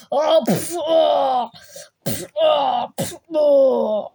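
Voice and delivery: puffed punch noises and shouted aa cries; fight grunts. Pretend. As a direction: mimic a fistfight, punching and yelling. Mock fistfight sound effects. (yumruk sesleri ve bağırış taklidi)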